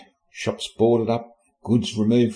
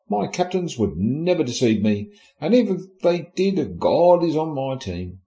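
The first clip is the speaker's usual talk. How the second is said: ordinary talk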